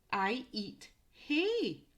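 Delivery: slow, deliberate clue reading in the animal's voice. Shown as a demonstration. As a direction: read each word slowly for children.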